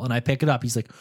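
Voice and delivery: usual speaking voice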